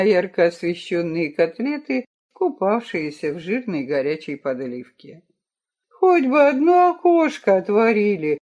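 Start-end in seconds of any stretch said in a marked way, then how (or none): 5.9-8.4 hopeless whining, higher and louder; whiny. Acted. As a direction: whine it out, defeated. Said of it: "ноющим голосом безнадёжно проговорил Митя".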